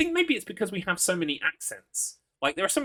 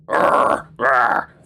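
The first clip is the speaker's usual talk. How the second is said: loud throaty growls; two loud mock action-hero growls; Snake-style growl; 3 semitones lower, 7 dB louder than usual. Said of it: "you did the Snake one".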